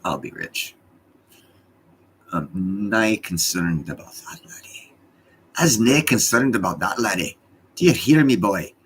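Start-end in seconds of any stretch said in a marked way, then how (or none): 2.3-4.9 put-on a light, slow Scottish accent; lightly put-on Scottish burr, unhurried. Streamer teasing viewers in a slow, lightly done Scottish accent. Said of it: "I'm speaking to you in my Scottish voice". 5.6-8.7 louder, higher hammed-up Scottish accent. Streamer teasing viewers in a loud, hammed-up Scottish accent. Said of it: "I'm speaking to you in my Scottish voice".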